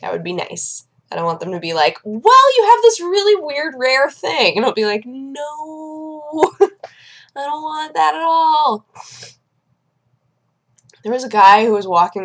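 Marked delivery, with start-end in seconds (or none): 2.0-4.6 high mock doctor voice, starting loud; imagined doctor's news. Imagined doctor tells patient of rare result, mock announcing. 5.1-8.9 high, drawn-out mock wail; mock dismayed whine. Patient reacting to imagined bad news, mock whining.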